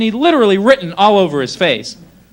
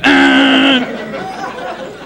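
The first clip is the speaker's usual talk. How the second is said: long, high honking noise; car horn honk; 6 semitones higher than usual. Retold or imitated. Driver honks at the stalled car ahead, long blaring beep. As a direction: imitate a horn held down. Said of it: "Give that horn a beep".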